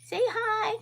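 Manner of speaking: very high, cooing pet voice; pet talk. Real, not acted. Owner coaxing her dog to greet viewers, high pet talk. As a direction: coo sweetly high to the dog.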